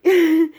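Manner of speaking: short loud high laugh. Real, not acted. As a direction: burst out in a quick high laugh. Laughs while telling it.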